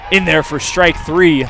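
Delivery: loud, drawn-out call of strike three; excited strike call. Real, not acted. Announcer calling the strikeout to radio listeners, loud and excited. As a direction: ring it out as the batter is rung up.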